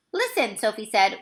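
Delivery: ordinary delivery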